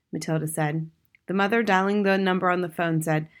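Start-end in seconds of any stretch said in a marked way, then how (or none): none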